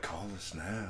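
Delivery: soft, breathy come-on; sultry ad voice. Retold or imitated. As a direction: purr it like a late-night ad. Sex line ad voice.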